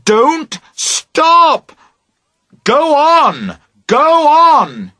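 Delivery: high, loud urging; shouting. A narrator's reading. Boat owner shouting to the girls to keep going. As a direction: urge them on at full voice.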